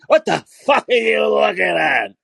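slow, drawn-out mock holler; high, stretched-out angry yell. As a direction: imitate a man hollering from across the street. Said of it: "and he yells at me".

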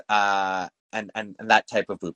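normal talking voice

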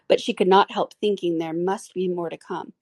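plain reading voice

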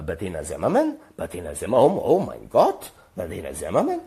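mock German accent, questions swooping up; excited German phone chatter. German landlady excitedly gossiping on the phone, imitated accent. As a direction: imitate astonished German gossip, voice leaping on the exclamation. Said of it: "she's excitedly talking on the phone in German".

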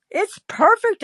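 "perfect" soars very high; high insisting. Retold or imitated. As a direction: reassure him with a high bright "It's perfect". Insisting to John about spaghetti.

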